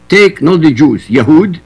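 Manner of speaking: ordinary delivery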